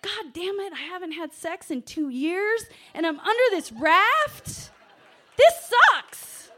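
high, loud exasperated rant climbing to a yell; furious at being stuck, building to a shriek; pissed off. Retold or imitated. Stuck under the raft, cursing her luck: high furious yell. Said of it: "I was really pissed off".

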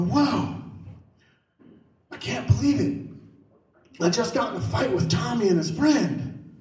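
high, mock-breathless excitement; retold amazement. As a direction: act out a kid bursting with news. Retold boast to his friends.

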